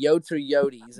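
"Yotes" as one syllable, then "Yotes" as YO-teez; two pronunciations offered. Retold or imitated. Asking a player how to say it, "Yotes" first short, then YO-teez. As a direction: contrast YOHTS with YO-teez in "Yotes or Yotes". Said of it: "How do you say it".

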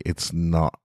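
usual speaking voice

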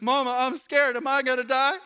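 put-on a frightened kid asking mom; scared kid. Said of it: "kid comes in".

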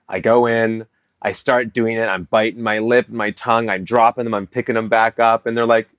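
ordinary delivery

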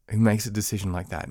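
normal talking voice

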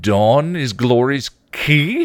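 slow, loud surprised blurt. A narrator's reading. Spike surprised about Dawn, loud blurt. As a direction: blurt it out in shock. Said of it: "he blurted".

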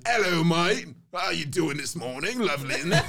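hammed-up English accent with a laugh; mock English accent. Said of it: "not even the only English accent".

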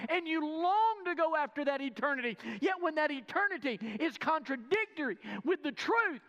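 loud, high fervent preaching. Acted. Preacher urging the church, loud and fervent. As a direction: preach it out to the congregation with fire.

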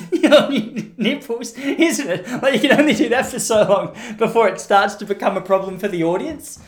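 high, laughing through the words. Real, not acted. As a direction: break up with laughter mid-sentence. Laughing at own bit.

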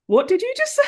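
high-pitched incredulous question; mock shocked disbelief. Friend hears her dismiss Pride and Prejudice, shocked.

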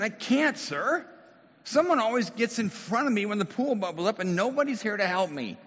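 high, pleading cry of a helpless man; a helpless man pouring out his frustration; raw emotional plea. Acted. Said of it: "I'm guessing he probably said".

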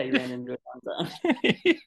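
can't hold the laugh back. Real, not acted. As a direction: laugh through the words. Joking along, laughing at the film.

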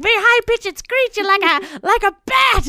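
very high, put-on screech; high and screechy. Hero showing off a voice, mock high screech. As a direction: squeak it way up like a bat. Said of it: "it was very high pitched".

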